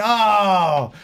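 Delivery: high, drawn-out fed-up protest; exasperated, climbing in pitch; exasperated outburst. Retold or imitated. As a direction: reenact snapping at the boss. Retold exasperated outburst at manager.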